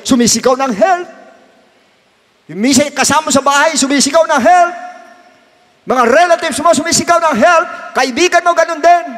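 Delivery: high, loud rising preacher's call; impassioned preaching. Acted. Pastor pleading with his congregation, high and loud. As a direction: preach it harder with each line.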